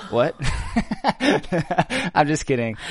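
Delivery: giggling through the words; laughing. Real, not acted. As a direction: laugh it out, teasing. Laughing while joking.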